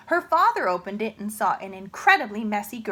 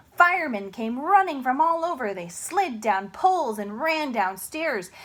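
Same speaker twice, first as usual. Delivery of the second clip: usual speaking voice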